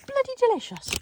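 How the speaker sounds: high delighted exclamation. Real, not acted. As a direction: savour it with a high, pleased exclamation. Tasting the ice lolly.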